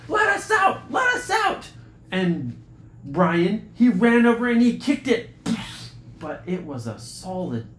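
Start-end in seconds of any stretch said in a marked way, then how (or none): 0.1-1.7 high, loud pounding-on-the-door shout; yelling to be let out. Acted. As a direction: shout through the door, trapped. Brady pounding on locked door.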